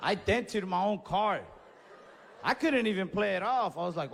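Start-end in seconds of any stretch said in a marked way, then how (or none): none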